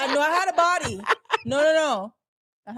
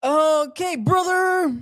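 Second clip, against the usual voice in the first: Hulk Hogan; 2 semitones higher, 4 dB louder, much slower than usual. Acted. Host playing a wrestler for co-host, loud wrestler impression. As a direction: drag out "brother" like a wrestler. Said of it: "Okay, do Hulk Hogan".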